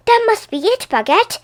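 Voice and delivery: high, eager cry; excited. Acted. Poppy telling her dog, excited and high. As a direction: sound thrilled by the new idea.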